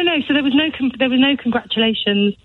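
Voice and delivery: ordinary talk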